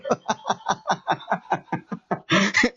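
long rolling laughter; amused run of chuckles; real laugh. Real, not acted. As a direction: laugh at the punchline for a good while. Laughs at angel anecdote.

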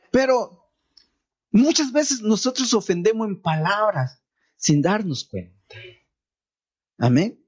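normal talking voice

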